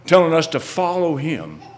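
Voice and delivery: loud, high preacher's questions; impassioned preaching. Real, not acted. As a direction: hammer each question at the congregation. Preacher presses the congregation.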